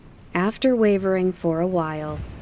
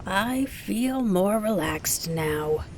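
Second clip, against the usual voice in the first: 1 townsperson; 3 semitones higher, slower than usual. A narrator's reading. Townspeople praise her new flying.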